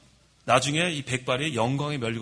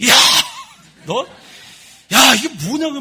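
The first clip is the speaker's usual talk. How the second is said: retold outburst, loud and high; angry parent's bark, acted out; sudden angry yell; 9 semitones higher, 9 dB louder than usual. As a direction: burst out scolding, re-enacting a lost temper. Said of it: "소리를 버럭 지르고".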